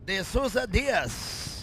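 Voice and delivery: slow, hammed-up Spanish accent. Shown as a demonstration. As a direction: roll out the name in a showy Spanish accent. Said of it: "say that with a Spanish accent".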